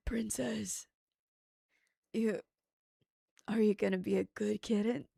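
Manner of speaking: whispery hammed-up daddy act. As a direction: play a quiet, breathy daddy voice. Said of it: "Do a daddy voice".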